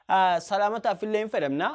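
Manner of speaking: usual speaking voice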